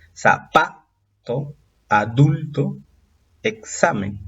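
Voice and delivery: "zapato, adulto, examen" slowly, stressing PA, DUL, XA; stress on penultimate syllable. Shown as a demonstration. Teacher demonstrates grave words, penultimate stress. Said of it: "presentan acento en la penúltima sílaba".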